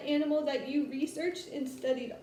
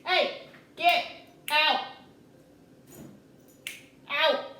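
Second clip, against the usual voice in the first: loud command to a dog; shouting at dog; 5 dB louder, slower than usual. Real, not acted. Ordering dog out again.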